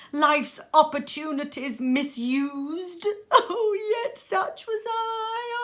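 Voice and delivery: high, loud crying lament; anguished lament. Acted. Ghost lamenting wasted life to Scrooge, high and crying. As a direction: sob out the regret. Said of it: "cried the phantom".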